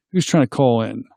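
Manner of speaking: normal talking voice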